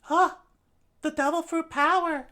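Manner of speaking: high, loud startled exclaim; shocked cry. Acted. Shocked soldier cries out.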